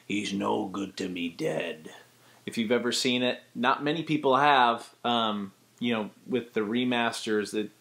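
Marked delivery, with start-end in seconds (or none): 0.0-1.9 low, raspy Boba Fett register; original Boba Fett voice. Shown as a demonstration. Tutor demonstrating the original Boba Fett actor. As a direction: lower and gravel-free, calm bounty hunter. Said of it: "he talks like this".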